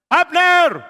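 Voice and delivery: high, loud holler; full-voiced wake-up call. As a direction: shout the name across a distance. Daud memanggil Abner dari jauh.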